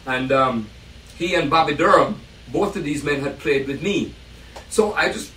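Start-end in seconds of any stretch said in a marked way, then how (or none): none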